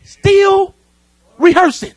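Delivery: high, loud marvelling punch on each word; amazed emphasis. Real, not acted. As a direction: sell the wonder of it. Marvelling at Jackson's late practice.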